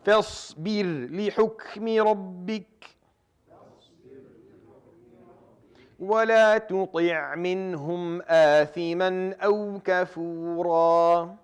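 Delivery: slow, melodic tajweed chanting with long held vowels; Quran recitation. Acted. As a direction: recite each verse in a drawn-out chanted melody.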